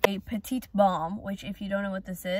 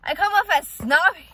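shocked; 9 semitones higher, 9 dB louder than usual. Real, not acted. Hearing sister's blunt verdict.

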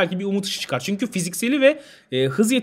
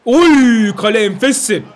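loud, thrilled cry at a near miss; excited outburst; 4 semitones higher, 7 dB louder, slower than usual. Real, not acted. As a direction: burst out in delight. Reacting to a great shot. (heyecanla bağırarak)

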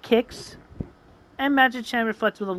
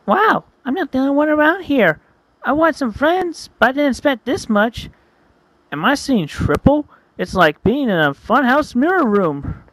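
high, eager hedgehog voice; excited character voice; 7 semitones higher, 4 dB louder than usual. Acted. Wild marvelling at the other hedgehogs, high and excited. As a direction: bubble over meeting new friends. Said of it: "starting from Wild".

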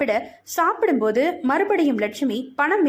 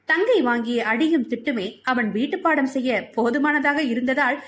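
ordinary delivery, nothing marked

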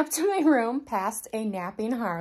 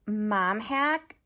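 ordinary talk

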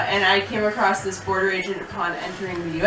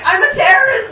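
high, louder mock confession; impulsive blurt; 11 semitones higher, 4 dB louder than usual. Traveller smitten with a good-looking border guard, blurting high. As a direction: blurt it out on a sudden urge. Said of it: "get the urge to be like".

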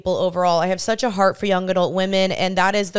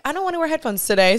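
whiny reluctance; 7 semitones higher, faster than usual. Retold or imitated. Retelling her own thought.

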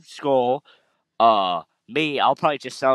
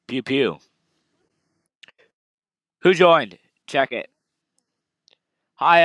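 ordinary delivery

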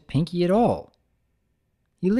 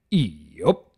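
low, terse farmhand grunt; one clipped low word; Big Mac yup; 4 semitones lower than usual. Acted. Big Mac answering shortly, low.

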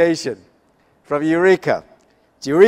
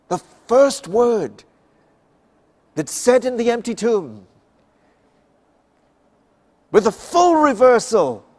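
emphatic preaching; 8 semitones higher, 5 dB louder than usual. Real, not acted. Preacher stresses the first word.

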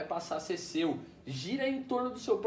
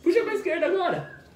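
much higher, louder urgent call; explosive goal commentary; 11 semitones higher, 5 dB louder than usual. Real, not acted. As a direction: shout the direction like a commentator. Urging the kid mid-play.

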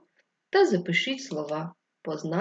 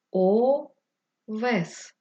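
о... вес, drawn out and split; syllable-by-syllable dictation; 3 semitones higher than usual. Shown as a demonstration. Teacher dictating the first word, syllable by syllable. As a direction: dictate in long separate syllables.

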